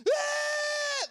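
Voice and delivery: sudden high, sustained infant scream; imitated piercing wail; baby's scream. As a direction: shriek out like a baby woken at night. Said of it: "my son just screams".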